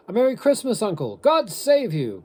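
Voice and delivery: high, loud, cheery greeting; cheerful cry. A narrator's reading. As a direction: call it out cheerfully, pitched right up. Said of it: "cried a cheerful voice".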